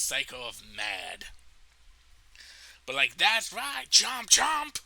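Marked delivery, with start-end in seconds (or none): 3.2-4.8 high, loud, gloating yell; mad taunt. Acted. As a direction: yell it as a crazed brag. Acting his mad taunt.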